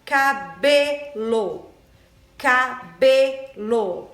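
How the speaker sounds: "cabelo" very slow in separate syllables, BE strong; loud, drawn-out "cabelo" for a class; stress on BE. Shown as a demonstration. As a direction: stretch out "cabelo" as ca-BE-lo. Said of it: "Qual é a sílaba mais forte".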